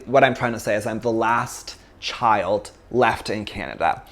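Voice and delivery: ordinary delivery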